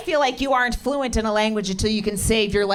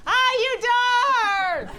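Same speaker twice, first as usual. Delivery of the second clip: high yell; 14 semitones higher than usual. Retold or imitated. Son yelling for help.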